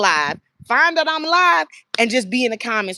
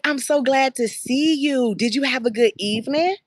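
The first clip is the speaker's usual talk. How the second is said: put-on higher, bright welcome to a child, slow at first; cheerful greeting; 4 semitones higher than usual. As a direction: greet a child at the door with delight.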